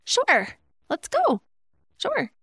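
high, quick, keen agreement; eager sure. Shown as a demonstration. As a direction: chirp a high-pitched, eager yes to the invitation. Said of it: "Or I could say; it means two different things".

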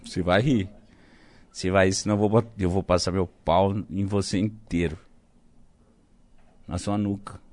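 low, soft mock-menacing taunt; low teasing voice. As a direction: goad him quietly to break. Provoking friend to laugh.

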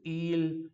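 il with a true L; drawn-out L in il. Shown as a demonstration. Teacher contrasting Italian with Portuguese L, drawn-out L in il. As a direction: hold the L of il, no U sound. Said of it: "Com esse L bem puxado".

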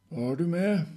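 high, loud question; demanding question. Acted. Proval questioning Travinka about her bags, demanding. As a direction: ask it sharply and loudly.

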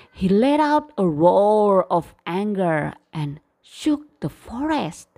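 ordinary delivery

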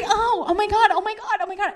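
high, fluttery panicked repetition; retold panic. Cornered by a big man, acting panicked. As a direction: squeak it out in mock panic.

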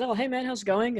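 overly chummy hello to a man ready to fight; cheery greeting. Retold or imitated. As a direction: greet him like an old pal. Greeting the angry heckler.

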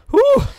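high, loud "whew" at the heat; hot-air exclamation. Retold or imitated. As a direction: blow out a loud high whew like you just hit a wall of heat. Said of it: "and I'm like".

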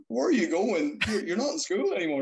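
a high mock-alarmed mother; mom. Mom catching son leaving for school, high imitated alarm.

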